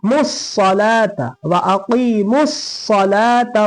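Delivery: मुस्सलाता with the doubled s pressed hard. Shown as a demonstration. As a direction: join the m into the s of मुस्सलाता, firmly. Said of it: "मीम को स्वाद में सख्ती, सख्त आवाज से".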